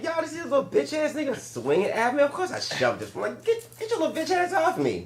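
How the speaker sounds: high-pitched, exasperated protest; mock indignant retort. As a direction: act out an aggrieved man defending himself. Acting the man's indignant retort.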